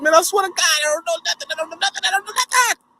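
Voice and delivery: high, tearful pleading; crying denial. Retold or imitated. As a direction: plead through tears. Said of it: "I broke down crying".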